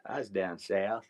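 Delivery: slow, stretched southern cowboy drawl. Retold or imitated. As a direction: imitate an uncle's thick southern drawl. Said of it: "heard his southern drawl".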